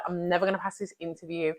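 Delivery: soft, quick murmur of doubt; hushed and anxious, like a worried thought; doubtful thought. Retold or imitated. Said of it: "a thought like".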